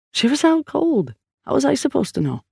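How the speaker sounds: high-pitched protest; defensive redhead. A narrator's reading. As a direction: plead innocence in a high character voice. Redhead defensively answers his partner's complaint.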